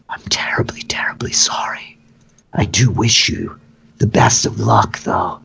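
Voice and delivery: hushed, whispery villainous sympathy; Vergen. Acted. Host apologising to the noblewoman before hurting her, hushed and whispery.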